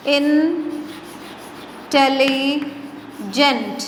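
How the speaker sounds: "Intelligent" very slowly in three parts, in - telli - gent; syllable-by-syllable teaching demo of "intelligent"; split into syllables. Shown as a demonstration. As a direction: break "intelligent" into three drawn-out chunks with long pauses. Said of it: "तीन हिस्सों में हो गया".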